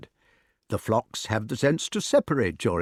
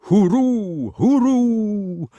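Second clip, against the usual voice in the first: high, loud hooting cry; owl hoot; 11 semitones higher, 6 dB louder, much slower than usual. A narrator's reading. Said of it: "cried Merlin, refusing to be damped".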